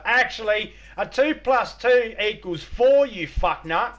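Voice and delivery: high, loud, slow know-it-all put-down; smug student retort. Retold or imitated. Student correcting a professor, loud and smug. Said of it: "did you say to a professor".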